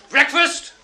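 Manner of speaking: high, loud call down the cells; bellowed announcement; screw's shout. Retold or imitated. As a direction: holler it to wake prisoners. Acted screw calling out.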